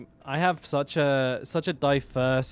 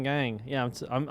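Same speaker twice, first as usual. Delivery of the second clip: ordinary talk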